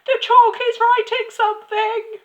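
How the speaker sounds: very high, loud schoolboy shriek; piercing, frantic squeal; screaming. A narrator's reading. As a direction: scream it in hysterical excitement. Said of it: "Nigel screamed".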